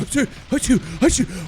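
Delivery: high, hammed-up sneezing fits; fake sneezes. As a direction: sneeze over and over, comically. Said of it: "sneezing, like".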